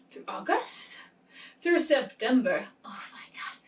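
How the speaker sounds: breathy whisper, catching a slip; whispered self-correction. Real, not acted. Booktuber realizing she got the month wrong, whispering. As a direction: hush it as you fix the mistake. Catches her own date mistake.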